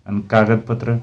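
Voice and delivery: usual speaking voice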